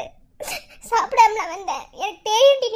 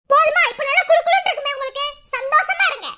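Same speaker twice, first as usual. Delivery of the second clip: high, raised angry taunt; bitter sarcasm; 6 semitones higher, 4 dB louder than usual. Acted. Friend rebuking those who hurt Venba, angry sarcasm. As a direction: throw it at them, pitched up and indignant. Bitter scolding after the insult.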